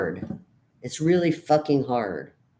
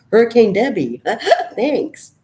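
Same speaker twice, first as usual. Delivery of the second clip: wry laugh; 7 semitones higher, 8 dB louder than usual. Real, not acted.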